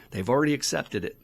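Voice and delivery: ordinary talk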